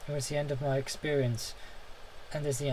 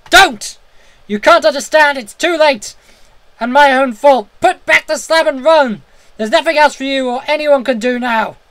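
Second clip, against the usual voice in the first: high, loud screaming in despair; 11 semitones higher, 17 dB louder than usual. A narrator's reading. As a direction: scream at him to run. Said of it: "a scream of utter despair".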